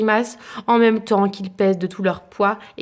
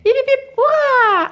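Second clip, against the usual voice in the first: much higher, louder cheer; cheering crowd; 13 semitones higher, 5 dB louder than usual. Acted. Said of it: "acclame la foule".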